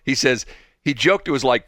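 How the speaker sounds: ordinary talk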